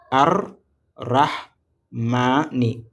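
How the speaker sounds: "Ar, rah, ma, ni" as four separate beats; teacher breaking the word into pieces; syllable by syllable. Shown as a demonstration. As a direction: count out the syllables with gaps. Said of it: "combien de syllabes?".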